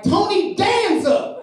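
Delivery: drawn-out, high-pitched taunt; triumphant shout. Retold or imitated. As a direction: crow it out slowly after scoring. Teacher's mock boast after scoring.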